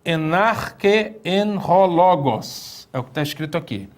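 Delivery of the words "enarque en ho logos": enarque en ho logos read very slowly and carefully as Greek; slow ceremonial Greek reading. Shown as a demonstration. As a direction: spell out enarque en ho logos word by word. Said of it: "Esse texto diz assim".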